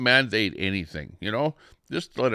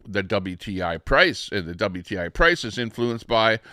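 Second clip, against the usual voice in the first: ordinary talk, nothing marked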